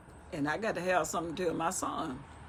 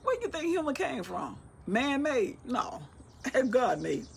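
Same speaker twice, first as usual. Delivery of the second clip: ordinary delivery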